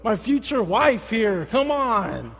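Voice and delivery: high, loud mock whine at mom; hammed-up teen indignation, stretched words; exasperated teenager. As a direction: plead with your mother to get off the line. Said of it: "I'm like".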